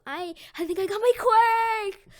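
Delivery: high, loud acted outburst; excited discovery. Deku discovers his power, excited. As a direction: build into a thrilled, high shout.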